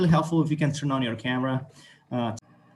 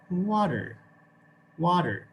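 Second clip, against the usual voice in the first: water with a clear American R at the end; American English water; 4 semitones higher, slower than usual. Shown as a demonstration. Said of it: "how the Americans actually say it".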